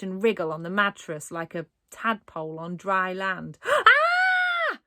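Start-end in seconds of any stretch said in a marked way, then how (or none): 3.6-4.8 piercing high scream. A narrator's reading. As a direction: let out a shrill scream. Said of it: "screamed Raj".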